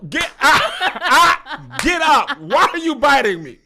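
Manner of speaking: high, loud panicked "Get up" while laughing; alarmed shout. Acted. A woman shoving off a biting lover, alarmed shout. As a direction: act shocked at being bitten.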